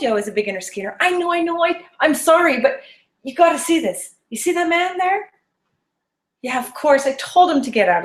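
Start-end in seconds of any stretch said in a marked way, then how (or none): 1.0-5.3 eager friend. Retold or imitated. Friend voiced, apologetic and eager.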